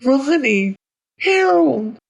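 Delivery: put-on high, loud child's scream; desperate little-boy shout; screaming, pleading. A narrator's reading. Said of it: "screaming, pleading".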